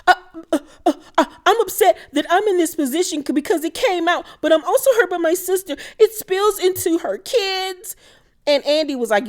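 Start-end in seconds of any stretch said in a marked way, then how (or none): 0.1-8.0 high-pitched mocking imitation of an upset woman; mock upset whining. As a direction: whine it up, stammering into it in a much higher voice. Mocking retelling of upset sister's answer.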